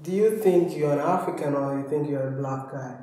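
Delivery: usual speaking voice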